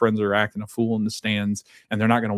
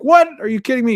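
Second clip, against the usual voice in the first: mock outrage; 8 dB louder, faster than usual. Derek outraged about face paint.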